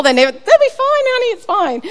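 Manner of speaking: high, loud hammed-up reassurance; high, loud mock "it's fine, honey"; mock soothing. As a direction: parody someone brushing off worry, sing-song.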